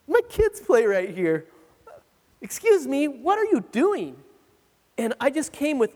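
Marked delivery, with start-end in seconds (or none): 0.1-4.2 indignant, higher-pitched protective challenge; confrontational attitude. Retold or imitated. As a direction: confront them like a protective papa bear. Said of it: "like, this attitude".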